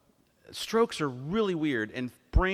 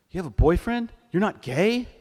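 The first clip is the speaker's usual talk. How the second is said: loud, high questioning imitation; playfully acted disbelief, rising at the end; mock incredulity; 5 semitones higher, 7 dB louder than usual. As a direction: imitate a blunt, astonished question. Dad questions therapist in disbelief.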